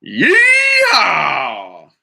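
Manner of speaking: loud happy yeehaw; pony cheering as they set off; cowboy whoop. Acted. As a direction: whoop it out like a rodeo rider.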